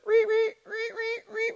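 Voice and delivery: high squeaky ree of a well rope; creaky bucket squeak. Retold or imitated. Preacher imitating the well bucket for the congregation, high squeaky creak. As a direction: squeak like an old well pulley. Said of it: "he hears the bucket from the well start to".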